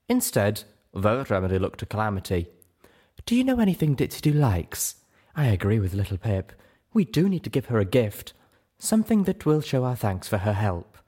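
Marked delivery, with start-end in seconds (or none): 3.2-11.0 higher-pitched, polite and reasonable; tactful lady figure. Acted. Velvet asks Calamity what gift would suit, higher courteous voice. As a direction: ask and propose courteously, pitch lifted.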